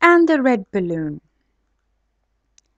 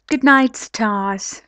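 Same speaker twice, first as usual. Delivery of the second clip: plain reading voice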